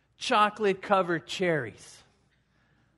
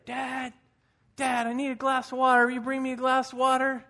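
put-on little boy calling; 5 semitones higher than usual. Boy in bed calling his dad for water, high pleading child voice.

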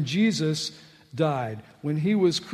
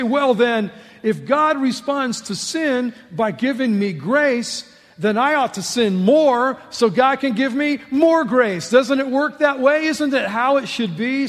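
smart aleck; 7 semitones higher, 6 dB louder than usual. Retold or imitated. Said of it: "somebody's gonna get really smart".

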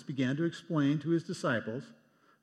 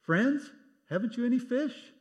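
much higher, raised call across the water; 11 semitones higher, 4 dB louder than usual. Retold or imitated. Jesus calling from the shore to the fishing disciples, raised call. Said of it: "He called out to them".

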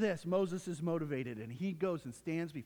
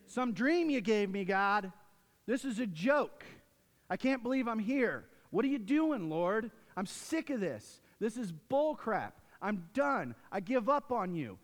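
louder and higher, exasperated rant; raised-voice bitter griping; frustrated complaint to God; 4 semitones higher, 4 dB louder than usual. Retold or imitated. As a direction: vent at God, fed up. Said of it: "That would be the conversation".

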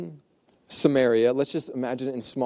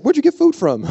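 much higher, loud baffled squawk; hammed-up disbelief, pitched way up; baffled disciple; 13 semitones higher, 11 dB louder than usual. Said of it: "And he's like".

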